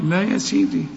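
ordinary talk, nothing marked

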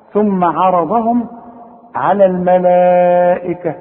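slow chant, "الملائكة" held long on its aa; correct recitation with madd. Shown as a demonstration. Teacher corrects the learner, slowly recited with long "الملائكة". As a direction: recite "ثم عرضهم" evenly, then stretch "الملائكة". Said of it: "مد برضو أربع حركات".

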